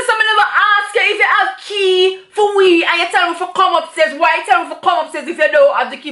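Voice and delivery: retold hotel argument, heated and loud; getting heated. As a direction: push back hard at the useless porter. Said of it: "Me start get dark on the man".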